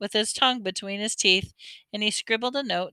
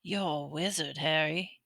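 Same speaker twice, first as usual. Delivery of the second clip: softer, lower gentle voice; 3 semitones lower, 8 dB quieter than usual. A narrator's reading. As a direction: tell him kindly and quietly. Hagrid gently repeats.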